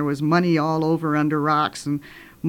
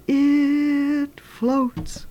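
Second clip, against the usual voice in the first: high, drawn-out eerie moan; haunting high wail, held long; ghostly voice; 8 semitones higher than usual. Acted. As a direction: stretch it out like a distant ghost. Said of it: "the voice came a little closer".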